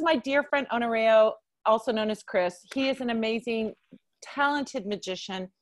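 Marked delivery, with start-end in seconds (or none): none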